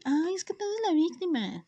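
high mock whiny voice; mocking quote. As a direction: pitch it up like a mocking friend. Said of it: "como cierta persona me decía".

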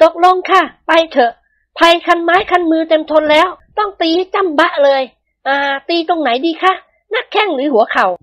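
high, firm woman's voice; a wife set on catching her husband; high-voiced wife, resolute and grim; determined young wife. A narrator's reading. Wives plan the beating.

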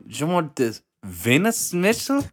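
high, hammed-up German accent. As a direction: play a pushy German vendor. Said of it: "You can't do that accent".

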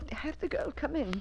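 unvoiced whispered order. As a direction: breathe the order, barely voiced. Whispering an instruction.

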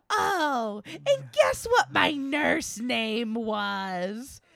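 slow, drawn-out teasing question; dragged out. Retold or imitated. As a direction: stretch every word like a tease. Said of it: "she dragged out every word".

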